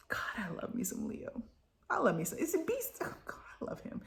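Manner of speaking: fast, breathless whisper of fan love; gushing whisper. Real, not acted. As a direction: whisper it like a secret crush. Gushing over a favourite character.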